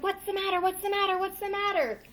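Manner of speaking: high, startled repeated question; alarmed mother. A narrator's reading. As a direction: rush out the words like a startled mom. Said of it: "His mother jumped out of bed and said".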